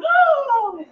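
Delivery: high rising moan that stops just short; a sharp high oh, almost there. Acted. Performer showing the audience the almost moan, high and unfinished. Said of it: "The almost moan".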